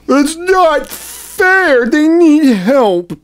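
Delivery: high, slow whiny mock of the left. Cohost mocking the left's complaint, high whine. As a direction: stretch it out in a high, whining sneer.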